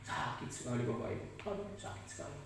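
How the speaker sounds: hushed, pained near-whisper; breathy pained hush; hurt. Real, not acted. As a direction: let the pain drop to almost nothing. Recalling deep hurt of being expelled.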